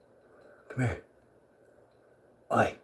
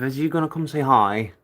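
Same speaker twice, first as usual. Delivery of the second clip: ordinary delivery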